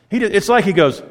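usual speaking voice

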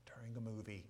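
hushed, breathless whisper of a son. Retold or imitated. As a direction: whisper it as if in a cinema. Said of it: "I'm often told".

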